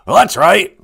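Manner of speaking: booming Great Spirit. Acted. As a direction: boom down from the sky like a spirit. Great Spirit answers question.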